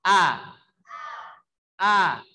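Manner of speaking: loud A with jaw one knuckle open. Shown as a demonstration. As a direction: sound A with a measured open mouth. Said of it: "Bunyikan ya". (A dengan bukaan satu ruas jari)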